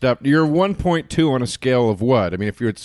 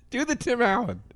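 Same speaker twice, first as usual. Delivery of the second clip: ordinary talk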